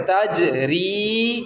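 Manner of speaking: تجری said very slowly with the ج bounced; teacher modelling the qalqalah on تجری; qalqalah demo. Shown as a demonstration. Teacher slowly showing the pupil تجری. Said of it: "اس کو قلقلہ کریں".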